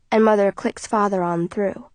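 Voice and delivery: ordinary delivery, nothing marked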